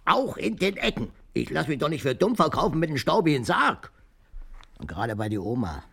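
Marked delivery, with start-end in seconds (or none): 0.1-3.9 laut und viel höher, nachgespielte Empörung; entrüstet lospoltern; empört. Retold or imitated. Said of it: "hab ich gesagt". (Opa spielt seine Empörung über den staubigen Sarg laut nach)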